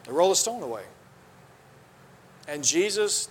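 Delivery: usual speaking voice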